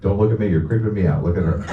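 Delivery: ordinary talk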